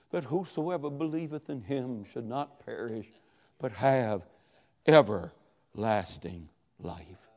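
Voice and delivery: put-on faint, low whisper of a dying boy. A narrator's reading. Dying boy whispering John three sixteen. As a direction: breathe the verse out barely audible. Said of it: "in a whisper he said".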